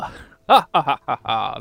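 loud villain cackle; evil laugh. Acted.

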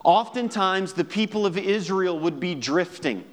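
normal talking voice

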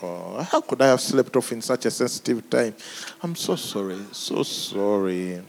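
low, soft mock-apologetic voice; hammed-up sorry Jesus to Pastor Chris; grovelling apology. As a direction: beg forgiveness like a fawning servant. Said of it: "how most Christians think".

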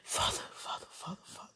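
whispering. Real, not acted.

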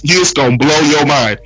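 ordinary talk

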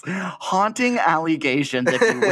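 high, slow stuffy voice; mock serious lady. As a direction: play it prim and overserious.

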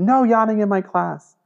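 high, loud mock reprimand; imitated teacher's yell. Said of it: "she would yell at every single person".

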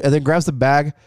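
normal talking voice

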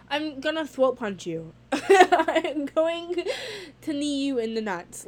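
giggling mock threat to throat punch; laughing threat. Real, not acted. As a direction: laugh through the threat, half joking. Laughing threat at unempathetic partner.

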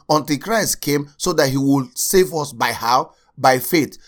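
ordinary talk, nothing marked

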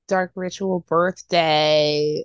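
slow dramatic. Acted. Hyping his birthday party.

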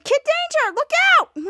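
very high, loud cry; shouted warning. Retold or imitated. Girl warning the hero, shouted. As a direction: call out urgent danger.

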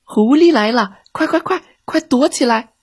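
high, frightened shriek of warning; mouse shrieking. A narrator's reading. Little mouse shrieking to friends to hide from the fox. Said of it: "小老鼠赛赛尖叫起来".

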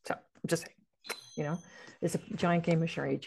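ordinary delivery

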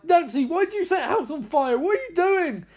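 high-pitched, incredulous scolding; mock-outraged dad voice; exasperated father. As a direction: demand answers like an alarmed dad. Father scolding daughter in the story.

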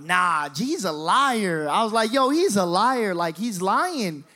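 loud, drawn-out protest; emphatic denial. Retold or imitated. Son blaming his cousin to Dad, loud indignant denial. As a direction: insist on it, stretching the key words.

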